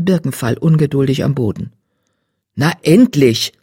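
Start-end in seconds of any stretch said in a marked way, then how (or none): 2.5-3.5 scolding. Acted. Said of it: "schimpfte er".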